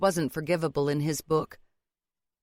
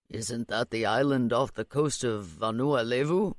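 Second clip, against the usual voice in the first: low male character voice, questioning; puzzled father; 5 semitones lower than usual. A narrator's reading. Father scratching his head at the odd word, low and puzzled. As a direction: ask in a low, mystified voice.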